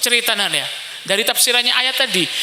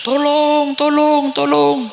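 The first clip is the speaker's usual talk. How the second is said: higher, pleading cries for help; cry for help; 5 semitones higher, much slower than usual. Acted. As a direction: call out like a soul dragged to hell. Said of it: "beda suara ring cerengan".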